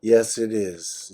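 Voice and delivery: ordinary talk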